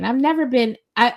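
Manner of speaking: ordinary talk, nothing marked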